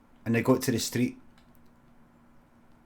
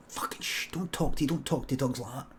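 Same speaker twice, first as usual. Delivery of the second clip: hushing; 8 dB quieter than usual. Acted. Man in dog costume hushes his companion, speaking very softly. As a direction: hush someone so nobody else hears.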